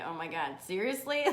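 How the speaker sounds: soft, low exasperated aside; weary disbelief. Acted. Host voicing someone in shit moments, quietly exasperated. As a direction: groan it under your breath. Acts out reaction to bad moments.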